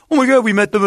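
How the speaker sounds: high gushing fan voice; fan excitement. Retold or imitated. Fan in front recognizing the radio host, excited. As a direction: gush like a starstruck listener. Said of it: "guy goes".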